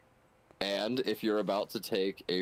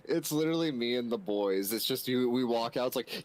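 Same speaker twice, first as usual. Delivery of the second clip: normal talking voice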